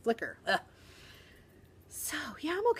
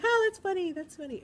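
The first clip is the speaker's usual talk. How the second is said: mock kid; 11 semitones higher, 6 dB louder than usual. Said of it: "they're like".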